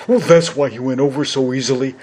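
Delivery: big, simple lion in mild wonder; cowardly lion. Acted. Lion remarks to Dorothy on the Scarecrow, mildly amazed lion voice.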